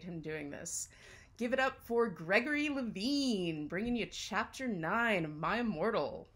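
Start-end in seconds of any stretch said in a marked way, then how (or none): 1.4-6.3 announcer introduction. Real, not acted. Host bringing on the guest performer, announcer introduction. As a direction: give it up for the act, emphatic.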